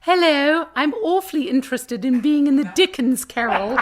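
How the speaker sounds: high, loud posh British phone voice; put-on British accent. Calling the theatre to ask about auditions in a British accent. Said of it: "I put my voice on".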